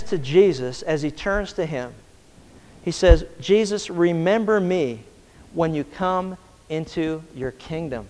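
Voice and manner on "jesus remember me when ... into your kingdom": slow, soft, humble plea; tender plea. Acted. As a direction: ask gently, almost breaking. Thief's quiet plea to Jesus.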